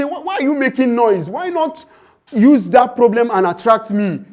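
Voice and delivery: high, loud scolding as Money; mock scolding. Money scolds the person complaining about their village, loud and high.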